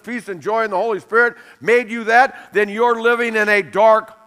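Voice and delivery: ordinary talk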